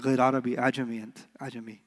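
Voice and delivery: low, fading teasing jab; mock teasing. College troll teasing his Moroccan friend, low and teasing. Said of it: "I was like".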